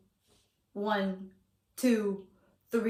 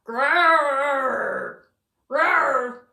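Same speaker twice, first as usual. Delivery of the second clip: bear growl; 4 dB louder than usual. Pretend. Growling like the bears on cover.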